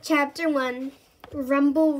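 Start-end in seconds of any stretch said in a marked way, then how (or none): 1.3-2.0 a big booming RUMBLE like a lorry; loud rumble. A narrator's reading. Reading to children, a booming lorry rumble. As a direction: boom out the truck noise. Sound effect of bin lorry.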